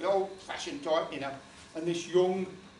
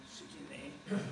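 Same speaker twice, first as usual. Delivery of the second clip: normal talking voice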